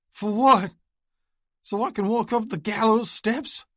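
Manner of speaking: high, loud anguished outburst; bitter despair. Acted. Wounded Oscar rejects Luke's hope, anguished. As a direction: spit it out in hopeless rage.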